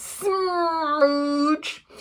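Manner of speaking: high, drawn-out comic smooch; hammed-up smacking kiss; big kiss noise. A narrator's reading. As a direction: stretch the word into a sloppy kiss.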